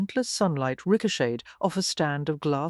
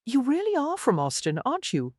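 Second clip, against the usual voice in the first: wry teasing question, starting high; dryly amused observation; 9 semitones higher than usual. A narrator's reading. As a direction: ask it wryly, dropping on the tag question. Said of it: "he asked wryly".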